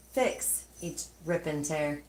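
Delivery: usual speaking voice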